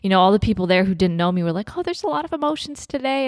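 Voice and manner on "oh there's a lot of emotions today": high, soft, quick imitation of onlookers; light, hushed high-pitched mimicry; cooing wedding guest. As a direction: imitate a guest gently commenting. Guests remarking on the crying bride.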